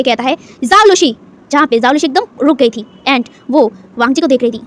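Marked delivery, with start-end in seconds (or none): 0.6-1.1 a sharp, loud, higher warning call of her name; angry raised voice. Acted. Husband shielding his wife, barking her name in anger. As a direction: stop her cold with her name. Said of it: "अपने गुस्से वाली tone को थोड़ा ऊंची करते हुए".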